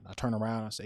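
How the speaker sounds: normal talking voice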